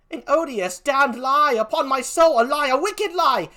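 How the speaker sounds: loud, high, outraged cry; furious accusation. Acted. Emilia accuses Iago.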